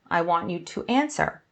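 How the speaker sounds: usual speaking voice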